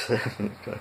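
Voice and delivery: a real, quiet burst of laughter; breaking into a soft laugh. Real, not acted. Narrator cracking up mid-scene: quiet real laugh. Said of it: "I can't keep a straight face doing this".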